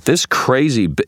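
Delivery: loud, high, cut-off outburst; outraged accusation. A narrator's reading. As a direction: accuse hotly until cut short.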